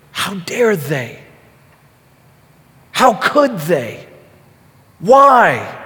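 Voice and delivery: slow, indignant questions building to an outburst on WHY; mock inner outrage, drawn out; acted anger. Voicing his own heart at those who hurt him, angry.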